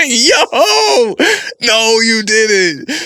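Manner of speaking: high, loud, slow mock gasp of outrage; mock outraged shock. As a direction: stretch it out like you can't believe she said that. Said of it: "I was like".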